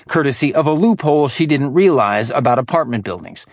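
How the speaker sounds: ordinary delivery, nothing marked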